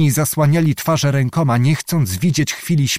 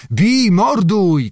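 slow drawn-out war cry, higher; battle cry; 7 semitones higher, much slower than usual. A narrator's reading. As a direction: bellow the attack order. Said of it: "krzyknął".

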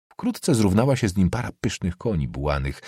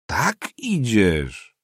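very slow, drawn-out repeat; wondering echo; 3 semitones higher, much slower than usual. Acted. Nobleman echoing the boy's answer, slowly wondering. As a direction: stretch both words out as you echo.

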